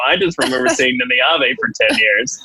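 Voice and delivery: ordinary talk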